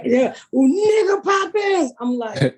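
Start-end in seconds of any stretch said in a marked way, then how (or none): 0.5-1.9 high, slow drawn-out yell from the crowd; fans yelling out. Retold or imitated. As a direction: mimic a fan calling across the room. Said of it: "They've started to yell out".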